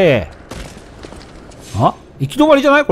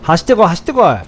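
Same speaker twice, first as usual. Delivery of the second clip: usual speaking voice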